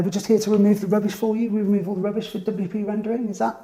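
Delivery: high, put-on posh English; poshest voice. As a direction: explain the job in an exaggeratedly posh voice. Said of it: "put on the poshest voice I can".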